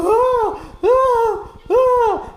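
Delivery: high mock moans of pleasure; mock moaning. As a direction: ham up the roommate's moans. Imitating roommate's moaning.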